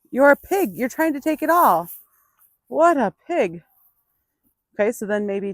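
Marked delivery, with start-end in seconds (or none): 0.1-3.6 higher and louder, mock scolding; playful teasing. Real, not acted. Trainer teasing her greedy horse, playful mock scolding. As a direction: tease him for hogging the oats.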